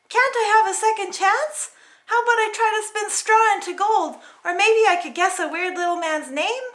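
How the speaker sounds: anxious bargaining; pleading princess. Acted. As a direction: beg for another chance. Paulina pleads.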